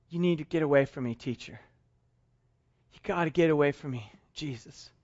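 low, soft, pleading confession; humbled, quiet plea. Acted. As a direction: plead in shame to leave. Peter begs Jesus to leave.

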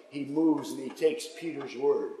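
usual speaking voice

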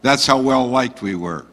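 ordinary talk, nothing marked

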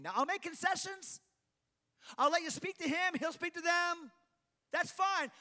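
high-pitched, emphatic refusal; insistent God. Acted. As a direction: stand your ground, voice pitched high. God refusing to change his mind.

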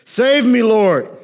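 loud, high cry for help; a sinking man's loud plea; cried out. A narrator's reading. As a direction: call out for rescue. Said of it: "he cried out".

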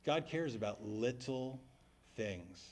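low, soft echo of the line; repeated softly. Shown as a demonstration. A pastor echoing the teacher to the congregation, soft and slow. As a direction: let the words sink in. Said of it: "repeats professor's line".

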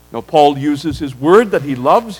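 ordinary delivery

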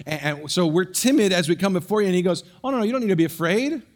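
loud, high, impassioned preaching; preaching at full force. Acted. Preacher voicing Joseph to the frightened brothers, loud and high. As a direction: pour it out from the pulpit, full voice.

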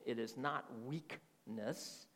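slow, each word spaced, "not" weighted; stress on not. Shown as a demonstration. Pastor repeating his point to listeners, slow with "not" stressed. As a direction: say it slowly, landing heavy on "not". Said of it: "Do you hear my enunciation?".

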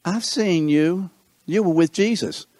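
put-on servant girl. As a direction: point him out in a high girl's voice.

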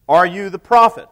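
demanding question, pushed out loud; loud, pointed challenge. Acted. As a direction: press him hard with the question. Priests question John.

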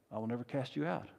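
soft, quick reassuring reply; hushed, steady promise; Jesus' reassurance. Acted. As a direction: answer the fearful sinner quietly. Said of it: "The response of Jesus".

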